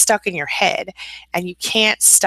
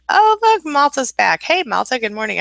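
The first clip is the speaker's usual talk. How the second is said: high, excited burst of welcome; light up at a returning regular; delighted greeting; 8 semitones higher, 3 dB louder than usual. Real, not acted. Host spots Malta back in chat, delighted greeting.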